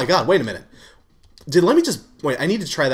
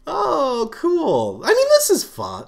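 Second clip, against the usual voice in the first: normal talking voice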